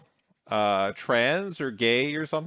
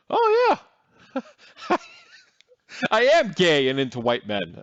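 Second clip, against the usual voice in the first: much higher, louder exaggerated gushing voice; mock impression of guest; 9 semitones higher, 4 dB louder than usual. Co-host mocking the guest reading about himself, high giddy impression. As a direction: ham up a giddy reaction to a search result.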